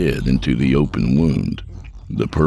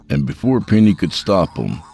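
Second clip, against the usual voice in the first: ordinary delivery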